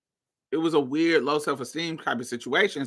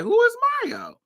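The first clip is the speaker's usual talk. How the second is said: confused quote; faster than usual. Retold or imitated. Boy asking about Mario.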